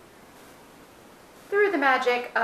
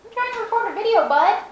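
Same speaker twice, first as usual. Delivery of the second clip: higher, louder pet talk; talking to cat; 8 semitones higher, 6 dB louder than usual. Real, not acted. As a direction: chide the cat fondly.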